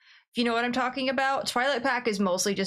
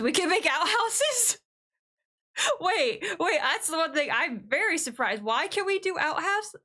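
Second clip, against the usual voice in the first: amused disbelief; 7 semitones higher than usual. Real, not acted. Surprised at outhouse feature.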